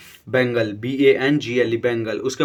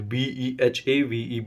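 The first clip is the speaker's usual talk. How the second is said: slow spelling; 4 dB quieter, much slower than usual. Shown as a demonstration. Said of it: "teacher spells out word".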